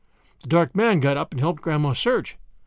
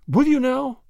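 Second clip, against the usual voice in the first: high, louder eager question; eagerly; 8 semitones higher, 3 dB louder than usual. Acted. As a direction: brighten up with hope. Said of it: "said Grandma eagerly".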